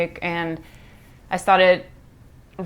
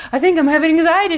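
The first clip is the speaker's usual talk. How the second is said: confessing to mom; 8 semitones higher, 4 dB louder, much faster than usual. Retold or imitated. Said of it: "I'm like".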